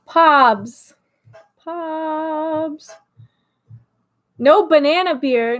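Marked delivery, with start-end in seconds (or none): 0.0-0.9 short, louder call of the name. Real, not acted. Calls a name two ways. 1.6-2.9 softer, stretched-out name; soft drawn-out call. Real, not acted.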